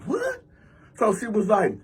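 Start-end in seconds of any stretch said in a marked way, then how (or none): 0.0-0.4 very high, soft rising "what?"; retold disbelief. Man reacts to woman squaring up, high-pitched disbelief. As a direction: squeak it upward in mock disbelief.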